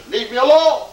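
angry. Retold or imitated. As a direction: imitate an angry man brushing someone off. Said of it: "He got angry".

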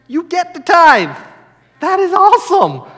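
high, loud, thrilled preaching; shouted enthusiasm; exuberant correction. Real, not acted. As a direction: burst out with delight at the privilege. Exuberant correction of reluctant giver.

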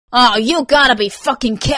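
loud, high exasperated curse; exasperated outburst; outraged. Acted. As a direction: explode in disbelief, then cut off. Hit by a bagel, outraged.

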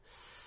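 "S" as one continuous soft hiss; long hiss. Shown as a demonstration. Teacher showing a long consonant, "S" as sustained hiss. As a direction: stretch the "S" sound out on the breath. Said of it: "Here, that's long".

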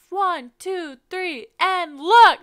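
counting out loud and high, slow on the last words; retold yell. Photographer shouting countdown to cousin, loud. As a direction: call the count to a model across a park. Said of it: "I was basically yelling at her".

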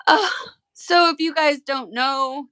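teary explanation to viewers; still teary. Real, not acted. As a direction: push on talking while still crying. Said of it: "I'm gonna start crying".

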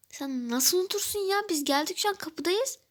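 you can't believe she forgot; upset friend. Acted. Friend upset about forgotten day. (arkadaşına sitem eden kız, yüksek ve öfkeli)

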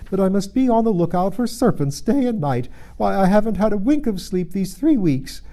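high, loud, aggrieved bird voice; harried pigeon. Acted. Pigeon complains of watching for serpents, exasperated. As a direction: moan loudly as a put-upon bird.

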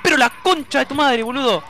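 exasperated curse. Real, not acted. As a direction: swear loudly in frustration at the game. Cursing at the game. (puteada exasperada)

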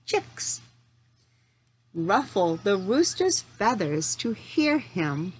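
ordinary delivery

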